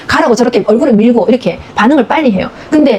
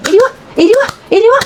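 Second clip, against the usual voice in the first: high sing-song come here; mother calling baby; 11 semitones higher than usual. Retold or imitated. Mother calls her baby to come, high coaxing. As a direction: coax the baby over in a high voice.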